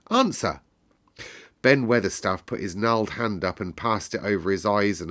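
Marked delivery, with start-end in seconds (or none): 0.1-0.6 higher, a sharp one-word order; haughty boy's order; imperious command. Acted. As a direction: bark it like a young rajah. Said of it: "still more imperiously".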